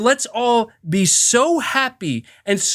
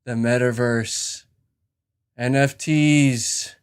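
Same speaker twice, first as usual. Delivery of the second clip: lower, softer, slow and dreamy; low, slow, half-hushed metaverse devotee; mock hype believer; 8 semitones lower, 3 dB quieter, slower than usual. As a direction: intone it like a hypnotized convert. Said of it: "more and more people are like".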